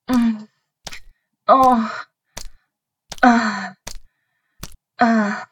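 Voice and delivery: loud breathy moans of pleasure; moaning. Acted. Partner moaning loudly in an intimate scene. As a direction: let the moans swell out loud, one after another.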